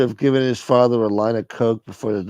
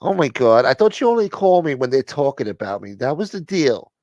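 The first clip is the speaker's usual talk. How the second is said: a higher, louder, put-out woman on the phone; indignant caller; 5 semitones higher, 3 dB louder than usual. Acted. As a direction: play her as theatrically offended. High voice in a phone skit.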